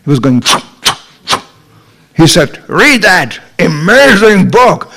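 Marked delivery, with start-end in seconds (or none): 2.7-4.9 higher, slow, emphatic salesman's urging; imitated persuasive book-pusher; convincing pitch. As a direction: sell it slowly with big conviction. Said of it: "he was so convincing".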